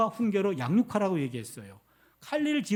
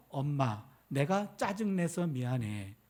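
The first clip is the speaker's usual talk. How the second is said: soft, low, slow retold apology; imitating tearful daughter; 7 semitones lower, 7 dB quieter, slower than usual. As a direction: play a child's subdued, sorry confession. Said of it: "딸 녀석이 울면서".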